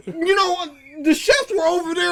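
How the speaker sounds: usual speaking voice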